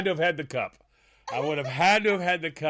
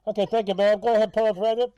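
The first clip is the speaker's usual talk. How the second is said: raised, louder mock intercom voice; drive-thru speaker voice; 5 semitones higher, 4 dB louder, faster than usual. Co-host playing the human menu board, garbled speaker voice. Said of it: "the whole change his voice".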